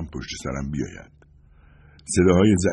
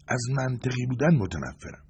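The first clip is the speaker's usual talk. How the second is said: higher-pitched mild grumble; grumbling; 6 semitones higher, 4 dB quieter than usual. A narrator's reading. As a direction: grumble it as a reluctant concession. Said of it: "غرغر کرد". (با غرغر ملایم)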